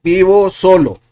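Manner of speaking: Vivo solo high and loud; loud model phrase. Shown as a demonstration. Teacher modeling Vivo solo for students. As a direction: announce it clearly to the class. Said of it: "teacher models sentence".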